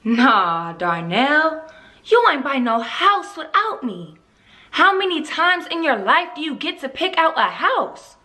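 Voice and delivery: put-on indignant young mother firing practical questions; Rena. Rena confronting Darnell about the surprise house, exasperated. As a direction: challenge him, pitch jumping on the key complaints.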